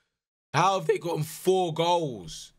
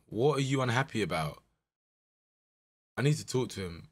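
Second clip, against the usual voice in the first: ordinary talk, nothing marked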